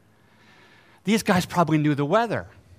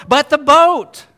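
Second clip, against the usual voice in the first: loud, high dramatic reading; emphatic shout; 9 semitones higher, 9 dB louder, slower than usual. Real, not acted. Preacher reading the verse to the congregation, emphatic shout. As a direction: punch out the phrase high and loud.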